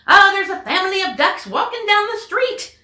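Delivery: high, loud excited report; excited policeman. Acted. Michael phoning Clancy about the ducks, excited and loud. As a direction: blurt it out, amazed. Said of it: "He called Clancy at headquarters and said".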